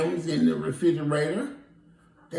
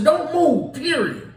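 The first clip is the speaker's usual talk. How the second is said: firm call; 9 semitones higher, 5 dB louder than usual. Retold or imitated. Ordering lost visitor to stay.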